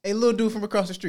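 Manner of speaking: high, fast hammed-up kid voice; sitcom street kid voice. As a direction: rattle it off like a cheeky neighborhood kid. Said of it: "my man's from".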